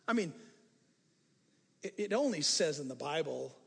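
usual speaking voice

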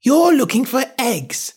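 very high, slow bird accusation; high-pitched suspicious pigeon; accusing pigeon. A narrator's reading. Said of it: "gave the pigeon the opportunity of adding".

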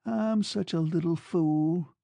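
high, slow, broken confession; broken murmur. A narrator's reading. Woman confessing to her lover in tears, broken murmur. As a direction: murmur it brokenly, stretching the words. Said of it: "she murmured brokenly".

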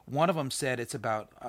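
ordinary delivery

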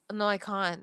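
ordinary talk

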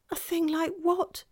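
put-on high little-girl question; puzzled child. A narrator's reading. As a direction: ask as a puzzled young girl. Child puzzled by faun's confession.

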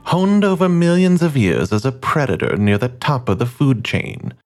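slow, grand wildlife-film narrator; measured documentary voice over music; nature documentary narration. Acted. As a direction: read it like a nature documentary host.

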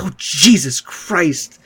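slow, mildly appalled exclamation; mortified. Retold or imitated. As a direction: draw it out, imitating a shocked onlooker. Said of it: "she was just mortified".

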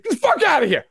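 high scoffing brush-off; hammed-up scoff; mock dismissive. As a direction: wave them off with contempt. Rival fans dismissing Bengals.

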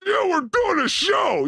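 big stretched cheer for the show; excited shout. Real, not acted. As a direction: announce it like a showman.

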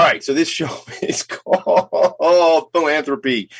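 laughing slowly through the title. Real, not acted. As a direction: chuckle while announcing. Laughing while announcing show.

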